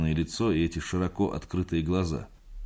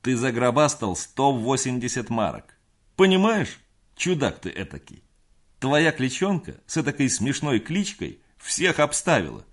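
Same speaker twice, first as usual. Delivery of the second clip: loud and high, thrilled; excited; 7 semitones higher, 4 dB louder than usual. A narrator's reading. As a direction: shout the news with glee. Gustav celebrates the win.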